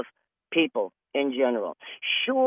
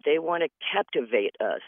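normal talking voice

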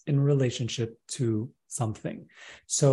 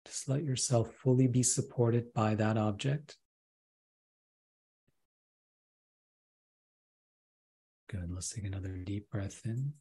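right in your ear. Acted. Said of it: "I want to take you up on that offer to do a process".

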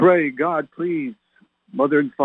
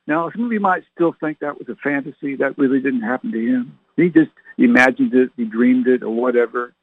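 normal talking voice

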